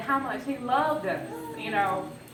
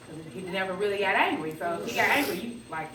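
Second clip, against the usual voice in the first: ordinary talk, nothing marked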